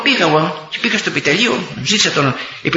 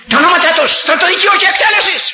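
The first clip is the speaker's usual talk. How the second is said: stern order; 13 semitones higher than usual. Retold or imitated. Chief of staff's harsh reply.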